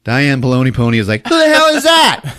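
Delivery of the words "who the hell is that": high, loud startled demand; hammed-up shocked girlfriend; mock alarmed yell. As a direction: shriek the question in character. Girlfriend startled by Blondie, retold.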